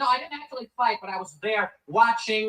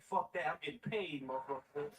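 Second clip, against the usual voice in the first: gruff refusal; 8 semitones lower, 11 dB quieter than usual. Retold or imitated. Brothers refusing, low voice.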